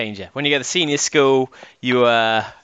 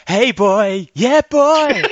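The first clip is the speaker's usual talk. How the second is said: put-on cool kid voice; 8 semitones higher, 6 dB louder, much slower than usual. Mock cool kids greeting.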